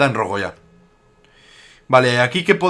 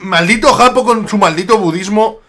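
frustrated cursing; 5 semitones higher, 4 dB louder than usual. Real, not acted. Cursing rival after attack.

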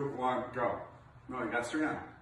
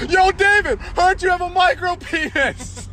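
imitating teen kids. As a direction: taunt like cheeky kids. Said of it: "these kids pass by and they go".

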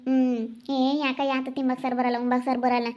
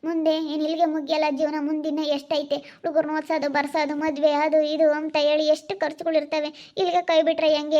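normal talking voice

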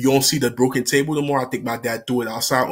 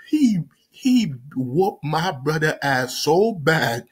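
usual speaking voice

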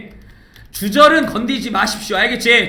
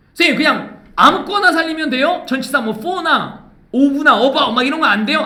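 higher, eager pupil pestering the teacher; mock student voice, raised and insistent; imitated student question; 4 semitones higher, 4 dB louder than usual. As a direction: play a student asking teacher, pitched up.

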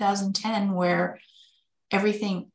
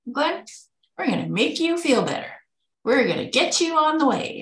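high, bright imitation of an upbeat doctor, louder; perky and happy; 7 semitones higher, 3 dB louder than usual. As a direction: mimic a cheery doctor bursting in, pitched up. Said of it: "just perky and just happy".

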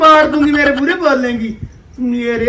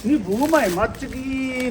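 disgusted; 8 dB quieter, slower than usual. Acted. Mother-in-law disgusted by mess.

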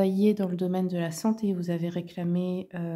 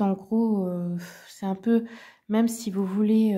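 ordinary delivery

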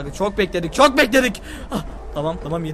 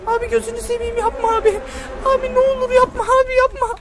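much higher, rising to a shriek; desperate pleading; 8 dB louder than usual. Real, not acted. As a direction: beg for mercy, terrified. Begging the monster, shrieking.

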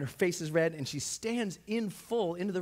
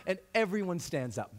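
usual speaking voice

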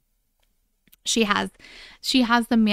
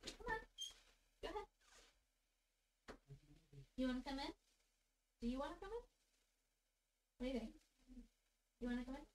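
hushed coaxing; 26 dB quieter, much faster than usual. Real, not acted. Streamer coaxing a cat to come in, hushed. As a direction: coax the cat in almost silently.

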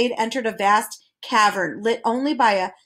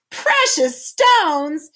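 high, drawn-out incredulous roar; 6 semitones higher, 3 dB louder, much slower than usual. A narrator's reading. Math magician answering Milo's question, roaring. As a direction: roar both words slowly, stretched wide. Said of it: "he roared even louder than before".

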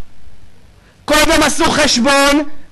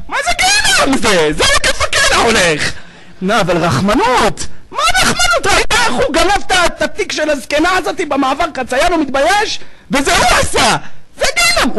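outraged high voice; 6 semitones higher than usual. Acted. New judge reacts to the film.

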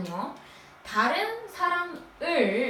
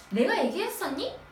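normal talking voice

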